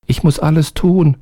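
high, small servant voice; eager little servant, high. A narrator's reading. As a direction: pitch up for the tiny obedient helper. Narrator voices the little man.

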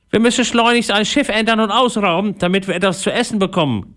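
high, put-on pirate growl. A narrator's reading. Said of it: "knurrte Einauge". (knurrender Pirat mit hoher Stimme)

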